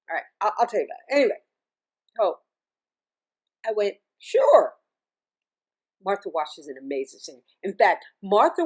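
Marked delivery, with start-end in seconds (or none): 4.2-4.7 a much higher, louder bright "Sure."; eager yes. Retold or imitated. Singer accepting a paid gig abroad, eager high sure. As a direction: jump at the offer with a high, loud "Sure.".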